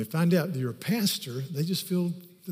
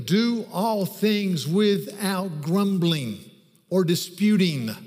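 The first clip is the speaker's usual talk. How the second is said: slow, loud, high preaching of the verse; preaching scripture; 3 semitones higher, 4 dB louder, slower than usual. Acted. Pastor reads Philippians to congregation, slow and loud. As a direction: proclaim each phrase with weight.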